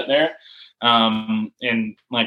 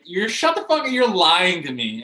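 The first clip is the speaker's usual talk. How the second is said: high, incredulous retold outburst; disbelieving; 5 semitones higher than usual. Player to coach after selection call, disbelieving.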